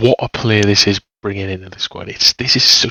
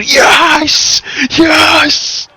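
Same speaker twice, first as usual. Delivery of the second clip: excited shout; 8 dB louder than usual. Real, not acted. Last minute winner celebration.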